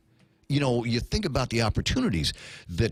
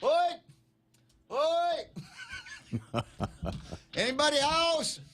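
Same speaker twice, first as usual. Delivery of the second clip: laughing mock holler announcing himself; imagined governor calling out; 14 semitones higher, 5 dB quieter, much slower than usual. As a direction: sing out a cheery hollering Oi up the stairs. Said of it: "I can almost hear him going".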